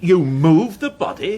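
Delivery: can't believe he touched it; alarmed surprise. Acted. Inspector startled that the constable moved the body, alarmed disbelief. Alarmed at possible evidence tampering.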